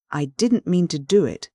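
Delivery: plain reading voice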